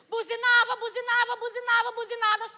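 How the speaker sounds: high, loud rattled repeats; loud, elated insistence; excited repetition. Retold or imitated. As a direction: punch it out like repeated honks. Excited retelling of honking.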